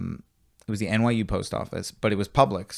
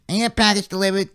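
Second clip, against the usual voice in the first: put-on high, fast old woman voice; old lady voice; 9 semitones higher, 5 dB louder, faster than usual. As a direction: do her odd old lady voice. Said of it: "Such a, such a specific voice".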